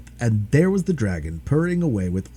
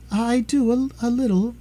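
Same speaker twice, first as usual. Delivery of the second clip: much higher, hesitant reply; timid mother; 11 semitones higher than usual. Acted. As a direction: answer shyly, unsure. Mother answers dragon.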